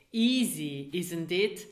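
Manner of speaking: normal talking voice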